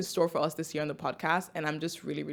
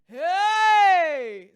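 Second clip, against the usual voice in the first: big shouted hey; loud greeting; 11 dB louder than usual. Real, not acted. Opening the episode, a loud shouted hey. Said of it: "Sorry, that was really loud".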